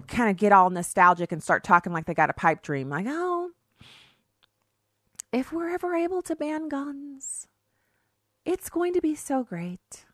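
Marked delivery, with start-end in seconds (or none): 3.1-10.0 high, soft, dreamy mock voice; mock dreamy liberal. Mocking gun-ban dreamers in a high soft voice. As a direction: float it up like a naive dreamer. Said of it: "that's how they talk".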